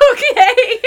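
high, loud giggling okay; can't stop giggling. Retold or imitated. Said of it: "And I was like".

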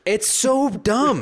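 exasperated whine. Real, not acted. Exasperated at Pac-12 deal.

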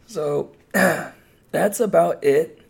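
rough, worn-out voice, slow; real strained hoarse throat. Real, not acted. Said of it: "My voice is super shot".

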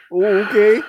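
sudden high, loud "Oh, okay"; mock surprise. Mocking an offended tweeter with fake shock.

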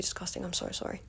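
whispered sheepish apology; hushed, embarrassed sorry. Real, not acted. Apologising right after a burp.

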